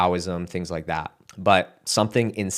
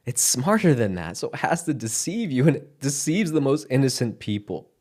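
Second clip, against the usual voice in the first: ordinary talk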